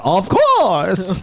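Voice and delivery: lilting Bane voice; Bane's sing-song "Of course"; Bane impression. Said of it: "and he's like".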